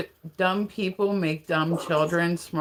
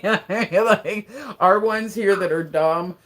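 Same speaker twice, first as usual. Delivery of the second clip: ordinary talk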